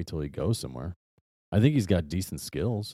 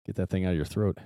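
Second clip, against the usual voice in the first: ordinary talk, nothing marked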